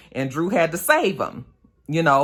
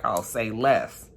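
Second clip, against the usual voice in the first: slow, lower, laid-back drawl; cool agreement; 6 semitones lower, 4 dB quieter, much slower than usual. Retold or imitated. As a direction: done deal, no more words. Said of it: "Kane was like".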